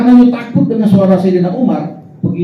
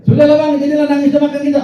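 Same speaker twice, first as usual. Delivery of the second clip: put-on high, loud and fast, a coaxing young wife; a wife urging her weepy husband to eat, high and fast; 8 semitones higher, 3 dB louder, much faster than usual. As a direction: hurry him to stop crying and eat.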